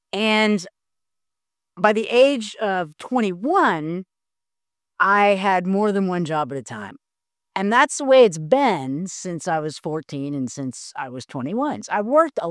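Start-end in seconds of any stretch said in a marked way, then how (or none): none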